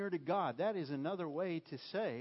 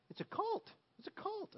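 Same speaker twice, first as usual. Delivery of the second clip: mock alarm. Mock alarm about football coaches.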